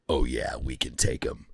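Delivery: low, cocky action-movie dude; mock tough guy. Reviewer mocking Sicario's dialogue, low cocky dude voice. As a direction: play the swaggering guy who's sure of himself. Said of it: "And another guy goes".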